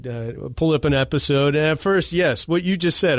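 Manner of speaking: normal talking voice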